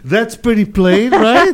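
laughing through the words; rising into a laugh on right. Real, not acted. Laughing at a crude joke.

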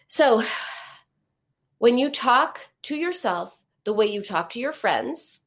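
ordinary talk

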